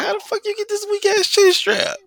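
hammed-up imitation of a masc woman; mock butch voice. Mocking a woman complaining about her chin strap. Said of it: "they just be like".

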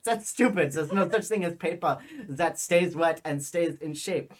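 put-on dismissive silly German accent; strong German accent. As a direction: scoff it in a comic German accent. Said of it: "I had a really strong, stupid accent".

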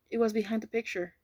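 plain reading voice

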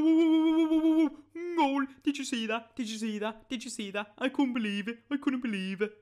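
high, loud, excited Brummie fan impression; hammed-up Brummie accent, pitched high; Birmingham accent. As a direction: imitate a thrilled Birmingham football supporter, whooping then gushing. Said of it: "Birmingham accent right there".

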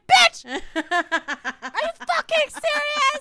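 screaming in mock outrage; incredulous shout. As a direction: yell it in outraged disbelief. Outburst at retail job reveal.

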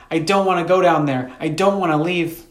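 high-pitched pleading refusal; cried out. A narrator's reading. Sister begging her brother not to leave, crying out. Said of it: "she cried".

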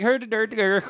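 high, garbled grunting gibberish; hunched adventurer voice. Acted. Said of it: "He's like".

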